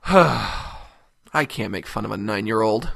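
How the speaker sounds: mock sincere. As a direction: fake being the bigger person. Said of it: "I'm being facetious, obviously".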